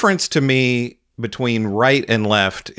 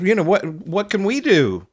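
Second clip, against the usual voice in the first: dismissive sigh-and-shrug mimicry, higher; a shrugging, sighing mock of a pundit; 7 semitones higher than usual. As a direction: play the eye-rolling pundit, pitched up. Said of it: "this creep sighs and rolls his eyes and shrugs".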